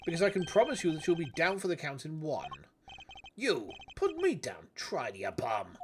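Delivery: gruff Bronx tough-guy con; thick Bronx accent. Acted. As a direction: lay on a heavy Bronx accent. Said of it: "such a thick Bronx accent".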